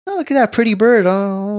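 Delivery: high, airy mock admiration; put-on high, wandering daydream voice; distracted admirer. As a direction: coo at a pretty sight, pitched well up. Acting out getting distracted while running.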